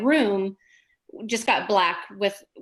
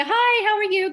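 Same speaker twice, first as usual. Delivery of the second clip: bright medium greeting; 12 semitones higher, 4 dB louder than usual. Retold or imitated. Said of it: "talks to me, say".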